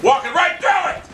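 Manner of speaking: high, exasperated outburst; exasperated yell. Real, not acted. Gamer watching enemies walk through fire, exasperated yell. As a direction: yell it in disbelief. Raging at missed shots.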